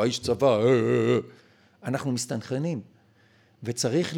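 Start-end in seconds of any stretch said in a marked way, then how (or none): 0.0-1.2 a hint of army-man speak; imitated army man. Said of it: "חיקוי של איש צבא".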